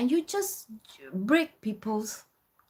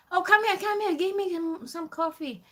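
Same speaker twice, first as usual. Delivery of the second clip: demanding customer; 11 semitones higher than usual. Retold or imitated. Customer outside summoning student for coffee, mock demanding. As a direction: imitate a customer calling the server over.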